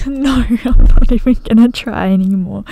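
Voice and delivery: loud, real laugh through the words. Real, not acted. Player failing to pronounce Wyoming, laughing as she gives up. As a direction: give up while cracking up.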